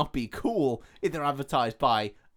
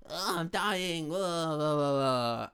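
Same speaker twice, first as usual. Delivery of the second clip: Robin Williams/character; 4 semitones higher, 4 dB quieter, much slower than usual. Acted. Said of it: "Robin Williams is like".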